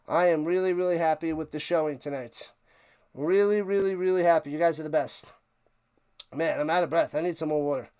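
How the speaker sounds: usual speaking voice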